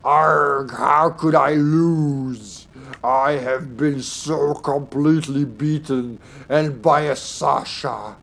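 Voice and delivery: slow, drawn-out theatrical despair; hammed-up lament of the vanquished, word by word; defeated villain lamenting. Streamer bemoaning a loss to Sasha, melodramatic slow lament.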